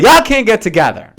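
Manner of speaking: mock British decree. British officials banning town meetings: loud mock order. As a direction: lay down the law, mock-stern. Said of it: "They're like".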